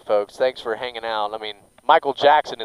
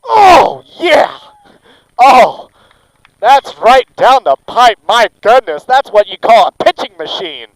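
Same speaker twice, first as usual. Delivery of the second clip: loud, high thrilled shouting; excited yell; 8 semitones higher, 10 dB louder than usual. Real, not acted. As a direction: roar at a perfect strike. Strike right down the pipe.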